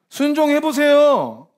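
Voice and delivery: 순종해보세요 dragged out high and loud; loud, slow command. Acted. Preacher calls the congregation to obey, loud and slow. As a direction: urge them loudly to obey. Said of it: "순종하라고 하나님 뜻 아니에요".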